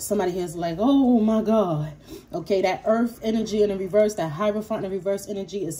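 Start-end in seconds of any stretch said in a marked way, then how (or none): 0.8-1.9 slow, louder, stretched-out disbelief; drawn-out oh my God. Retold or imitated. Imitating a shocked person, slow drawn-out disbelief. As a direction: drag it out like you can't believe it.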